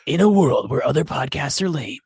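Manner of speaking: a hint of movie trailer narrator; slow dramatic trailer read. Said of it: "I wanted another impression".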